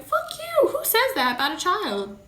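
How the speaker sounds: high, loud indignant outburst; outraged retort. Retold or imitated. Mother answers cruel commenters, high, loud outrage. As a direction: fire back in protective outrage.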